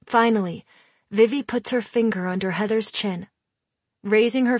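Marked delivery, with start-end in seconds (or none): none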